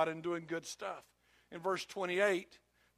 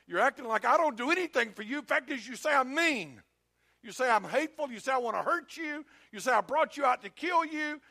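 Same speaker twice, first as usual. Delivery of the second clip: fed-up father; 3 semitones higher than usual. Acted. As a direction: list your grievances, climbing steadily in pitch. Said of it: "I'm just fed up with it".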